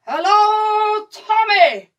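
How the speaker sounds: high, drawn-out loud hail; long shout. Acted. German soldier hailing the British trench, long shout. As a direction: holler it across a wide distance. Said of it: "And we hear the shout".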